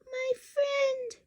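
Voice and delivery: a grateful tiny person far below; tiny Who voice. Acted. The speck voice speaks.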